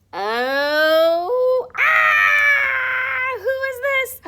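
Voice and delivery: high, loud playful scream; mock fright. Reading to children, pretending to fear the shark.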